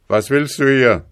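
loud, high bark at the intruder; guard shouting. A narrator's reading. Palace guard shouts at the farmer at the gate, loud and high. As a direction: yell it to stop the farmer at the gate. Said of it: "schrie ihn die Schildwache an".

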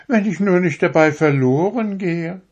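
high, proud little needle voice; a needle showing off how fine it is; vain darning needle. A narrator's reading. To the fingers, a vain needle in a high proud voice. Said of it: "sagte die Stopfnadel".

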